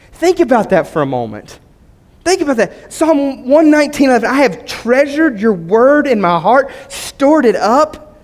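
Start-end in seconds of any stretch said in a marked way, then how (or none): 4.3-8.2 very slow, drawn-out emphatic reading; slow reverent emphasis; slow verse reading. Shown as a demonstration. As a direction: savor each word of the verse.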